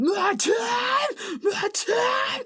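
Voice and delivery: high strained yelling of the words; mock scream. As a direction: scream it like the singer, hammed-up. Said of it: "also him screaming".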